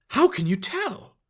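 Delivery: high, startled question; shocked. A narrator's reading. Disguised fairy caught out by the cashier, shocked high question. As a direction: gasp it out in surprise. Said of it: "She sounded shocked".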